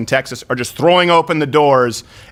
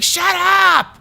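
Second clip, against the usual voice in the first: high sharp "Shut up!" pitched way up; mock wife yelling. Wife in headphones telling laughing men to shut up, high. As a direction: snap it like an annoyed wife in the back seat. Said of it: "she's like".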